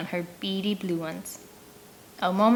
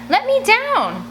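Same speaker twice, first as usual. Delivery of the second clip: much higher, louder desperate cry; desperate yell; 5 dB louder, slower than usual. Acted. Girl hung in a sack yells at captors, desperate. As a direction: yell to be let down. Girl hanging from tree yelling.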